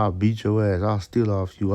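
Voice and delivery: ordinary delivery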